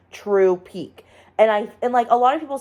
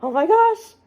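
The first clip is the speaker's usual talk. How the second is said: startled gasp; 10 semitones higher than usual. Retold or imitated. Reliving the scare of the movie.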